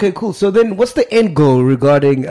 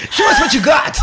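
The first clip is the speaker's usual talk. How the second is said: higher-pitched mock audition judge, laughing; 12 semitones higher than usual. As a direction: jokingly call out like a judge at an audition. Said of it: "acting like it's an audition".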